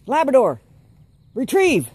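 high, loud retrieve order; calling out a command. Real, not acted. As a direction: call it like a dog handler.

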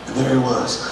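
normal talking voice

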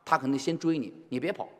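ordinary talk

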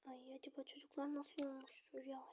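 soft unvoiced whisper. Father whispering worriedly about the children. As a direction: worry under the breath.

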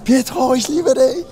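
high, slow, laughing fan shout; drawn-out squeal of a declaration; mock fan scream. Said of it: "Ich schrei einfach".

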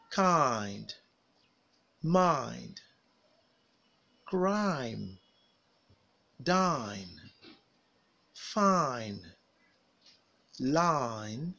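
each word alone from "Cries" to "mine", long I glide, falling tune; drilling I words. Shown as a demonstration. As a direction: model "Cries, fries, lie" singly with stretched I and pauses. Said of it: "repeat words with the I sound after me".

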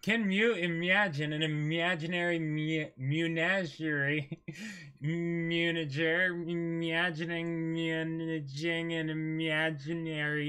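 slow, careful, stumbling read; reading aloud haltingly. Shown as a demonstration. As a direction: sound it out word by word. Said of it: "Just read it slowly".

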